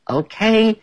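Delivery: high cheerful agreement; Ted happily agreeing to play; bright okay. Acted. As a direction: chirp it happily.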